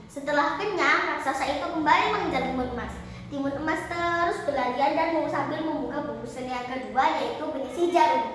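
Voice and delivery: ordinary delivery, nothing marked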